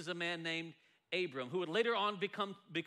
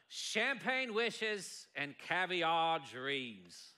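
very slow, drawn-out TV host in an attempted Australian accent; showy catchphrase in a badly faked Australian accent; 2 semitones lower, much slower than usual. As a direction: stretch each word in a rough Australian accent. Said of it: "That's my best Australian accent".

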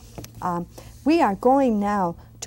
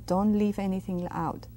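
ordinary delivery

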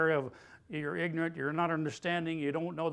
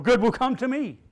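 loud declaration; 5 semitones higher, 9 dB louder than usual. Shown as a demonstration. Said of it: "let's make it personal".

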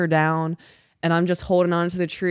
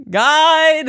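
higher, louder second holler; retold calling out; 9 semitones higher, 6 dB louder than usual. Stranded climbers calling the guide, louder holler.

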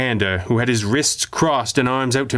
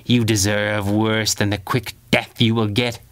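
through gritted teeth. A narrator's reading. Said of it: "breathed through gritted teeth".

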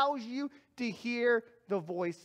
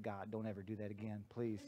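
hushed plea; 17 dB quieter, faster than usual. Retold or imitated. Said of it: "And I said".

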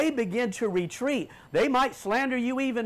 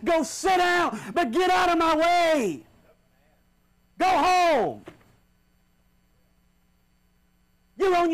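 shouted, high-pitched sermon climax; full-force shouting preacher; fiery preaching; 8 semitones higher, 3 dB louder than usual. Acted. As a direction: thunder at the congregation to get out of the way.

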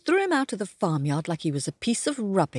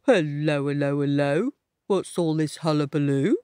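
low, inquiring donkey voice; low storybook donkey; donkey character; 3 semitones lower than usual. Acted. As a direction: play a nosy donkey, pitched down. Voices donkey greeting the dog.

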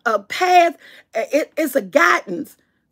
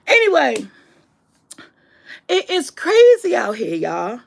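ordinary delivery

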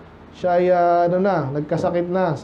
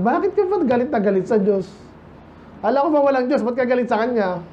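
higher-pitched questioning of an atheist; acted challenge; 5 semitones higher than usual. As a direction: press them with mock bafflement. (kunwaring nagtatanong nang may pagtataka)

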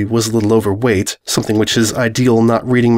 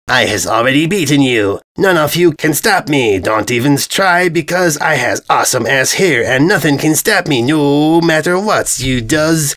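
dim invader voice; 3 semitones higher than usual. Acted. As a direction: brag like a dim armored oaf. Invader's bragging speech.

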